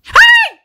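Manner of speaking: very high, piercing scream of terror; sudden loud shriek from a real fright; terrified scream. Real, not acted. Gamer jump-scared by the old woman, terrified scream. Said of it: "nunca dei um berro tão alto".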